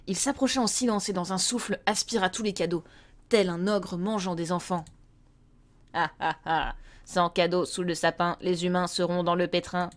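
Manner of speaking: storyteller reading a Christmas tale; story narration. A narrator's reading.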